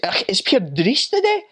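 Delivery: high-pitched broad Scottish brogue; Scottish accent. Acted. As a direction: put on a thick Scottish accent. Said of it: "They say".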